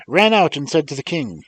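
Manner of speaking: ordinary delivery, nothing marked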